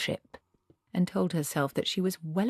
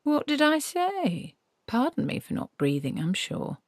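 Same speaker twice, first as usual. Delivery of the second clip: mock offended; 9 semitones higher than usual. A narrator's reading. The tree mocking offence at Granny's rebuke. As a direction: play the hurt innocent tree.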